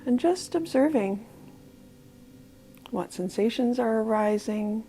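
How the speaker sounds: soft, unhurried body-scan voice with long pauses; guided meditation. Real, not acted. Leading listeners through a body scan from toes to legs, guided meditation. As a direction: gently guide them through the body, calm and quiet.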